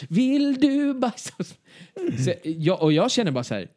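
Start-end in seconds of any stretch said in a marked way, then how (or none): 0.1-1.5 put-on high, slow childish whine; whiny plea. Asking strangers to use their toilet, high slow whining. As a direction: beg like a small child. Mock reply in same voice.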